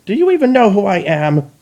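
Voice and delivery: high, loud haughty demand; imperious outrage. Acted. Chrysalis berating the Dalek, high and haughty. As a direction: demand they know who you are. Offended queen demands respect.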